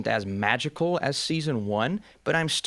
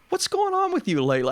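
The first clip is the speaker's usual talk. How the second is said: much higher mock wife voice, puzzled. Host imitating his wife, puzzled by the dad jokes. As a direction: squeak it like the exasperated wife. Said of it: "So she's like".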